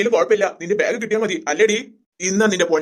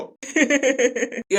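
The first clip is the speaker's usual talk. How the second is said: amused laughter; 3 semitones higher, 3 dB quieter than usual. Acted. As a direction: burst out giggling at the story. Amused by the retold story. (രസിച്ചു പൊട്ടിച്ചിരിക്കുന്നു)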